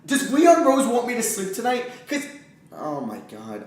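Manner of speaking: ordinary talk, nothing marked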